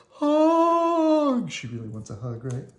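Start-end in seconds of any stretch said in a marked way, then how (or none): 0.1-1.5 put-on high childish plea; little girl. Little girl at the party asking for a hug, high. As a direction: beg it like a small child. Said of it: "the little girl at the party was like".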